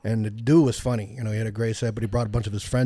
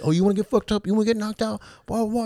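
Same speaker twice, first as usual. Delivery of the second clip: high mock thug taunt; imitating the bro picking a fight, higher; mock tough guy; 10 semitones higher, faster than usual. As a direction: ham up the meathead threatening. Aggressor threatens again.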